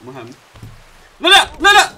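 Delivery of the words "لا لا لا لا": much higher and louder; loud wail. Real, not acted. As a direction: wail as it falls. Falls again, yells.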